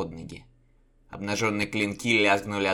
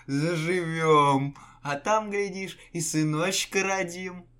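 dreamy tender; 9 semitones higher, 8 dB louder, slower than usual. A narrator's reading. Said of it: "веяло уютом, спокойствием и домашним теплом".

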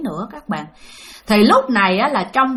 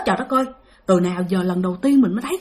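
usual speaking voice

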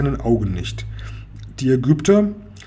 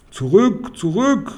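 pharaoh's alarmed cry, raised higher; mild yell; 6 semitones higher, slower than usual. A narrator's reading. Pharaoh shouting soldiers back from machine, mild alarmed yell. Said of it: "brüllt der Pharao".

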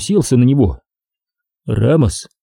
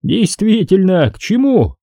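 slow, high drawl; drawn out; 9 semitones higher than usual. Acted. Ramos drawling the question back at Oltman, slow and high. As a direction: stretch it out mockingly. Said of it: "протянул Рамос".

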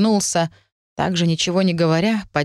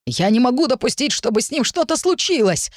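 urgent, higher blurt; 7 semitones higher than usual. A narrator's reading. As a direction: blurt it out in alarm. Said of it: "выпалил Эмбрис".

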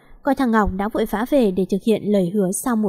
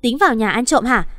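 ordinary delivery

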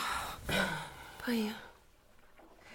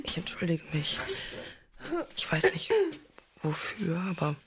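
normal talking voice

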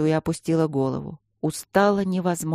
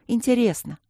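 higher, short, falling "Интересно"; sighing admission; 7 semitones higher than usual. A narrator's reading. Student confesses "Интересно" to her stone friends, high sighing admission. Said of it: "со вздохом призналась Алинка".

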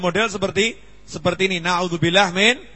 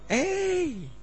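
casual hey; 12 semitones higher, 4 dB quieter than usual. Retold or imitated. Casual student greeting imitated.

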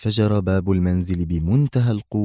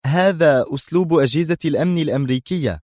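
higher-pitched strict verdict; stern; 7 semitones higher than usual. A narrator's reading. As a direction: state it sternly. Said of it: "وهي تجيب في صرامة".

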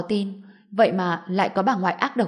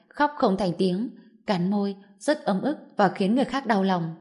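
ordinary talk, nothing marked

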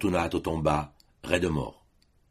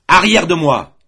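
a loud, high furious bark; furious shout; 10 semitones higher, 13 dB louder than usual. A narrator's reading. Father repelling his weeping son, furious shout. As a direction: drive him back with a roar. Mais Matteo lui cria.

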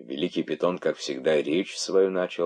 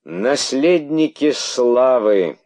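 slow, high, loud bitterness; slow bitter sneer; 8 semitones higher, 9 dB louder, much slower than usual. Acted. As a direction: drag it out with bitter scorn. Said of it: "продолжил он с горечью".

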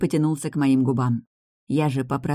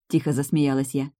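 ordinary delivery, nothing marked

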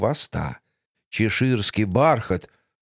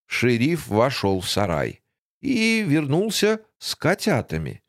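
plain reading voice

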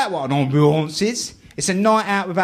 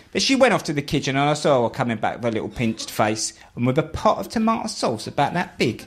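usual speaking voice

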